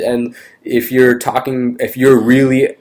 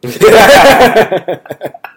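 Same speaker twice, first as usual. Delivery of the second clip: loud, high burst of laughter; real high-pitched guffaw; big laugh; 10 semitones higher, 7 dB louder than usual. Real, not acted. Laughing at the crude text translation.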